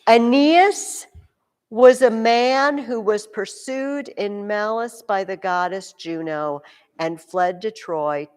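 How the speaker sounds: reads the sentence slowly and deliberately; slow reading aloud. Shown as a demonstration. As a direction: read it out slowly, word by word. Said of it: "Listen to [name]'s sentence".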